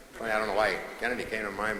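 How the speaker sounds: ordinary talk, nothing marked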